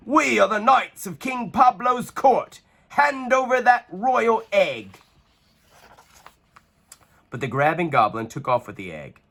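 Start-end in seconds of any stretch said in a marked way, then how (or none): 0.1-5.0 commanding knight. A narrator's reading. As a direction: demand the egg in the king's name. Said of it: "cried the knights".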